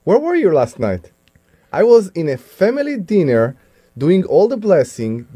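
normal talking voice

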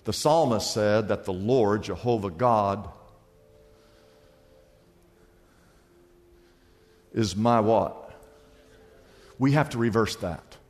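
hushed preaching voice under music; soft altar call. Acted. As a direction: draw them in gently, like an invitation. Quiet altar-call style over music.

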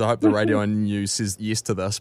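normal talking voice

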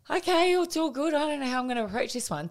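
bright voice hiding panic; forced calm. Retold or imitated. Mum brightly covers her shock at the sitter's answer.

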